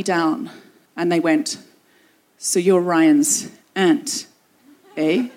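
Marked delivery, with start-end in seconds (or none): 2.4-5.3 a Canadian elder trying to sound threatening; sinister Canadian elder. Retold or imitated. Canadian elder interrogating her in a locked room, slow and sinister. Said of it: "hard to make the Canadian accent sinister".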